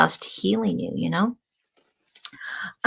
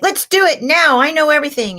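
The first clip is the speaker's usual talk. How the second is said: brash eager Aries; 10 semitones higher, 6 dB louder than usual. Pretend. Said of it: "So Aries is like".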